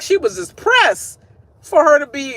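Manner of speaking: usual speaking voice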